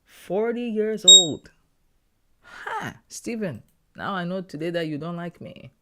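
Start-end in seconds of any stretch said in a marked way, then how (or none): none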